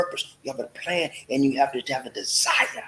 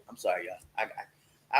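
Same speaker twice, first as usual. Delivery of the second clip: usual speaking voice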